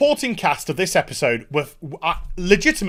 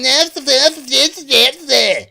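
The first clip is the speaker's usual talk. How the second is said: mocking her yeses; 7 semitones higher, 4 dB louder, slower than usual. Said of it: "She just goes around going".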